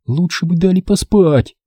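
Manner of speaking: high, sleepy and cross; annoyed, drowsy Ren. A narrator's reading. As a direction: grumble it half asleep. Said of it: "рассердилась она".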